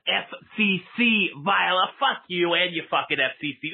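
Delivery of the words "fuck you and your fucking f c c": ordinary talk, nothing marked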